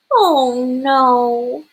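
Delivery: usual speaking voice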